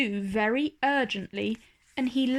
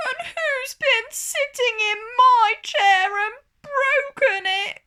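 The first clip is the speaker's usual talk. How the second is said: very high, louder, upset little bear; little bear crying; 13 semitones higher, 6 dB louder than usual. A narrator's reading. Little bear cries about his broken chair, very high and loud. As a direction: cry it out like a tiny bear. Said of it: "cried the little bear".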